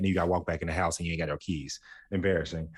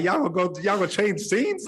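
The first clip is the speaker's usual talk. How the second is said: exasperated plea; 11 semitones higher, 4 dB louder than usual. Retold or imitated. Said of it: "It's like".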